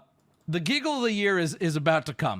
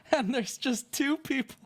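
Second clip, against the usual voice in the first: higher voice, giving way to laughter; breaking into laughter; 5 semitones higher than usual. Real, not acted. Storyteller reaching the punchline, breaking into laughter. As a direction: struggle to say it before laughing.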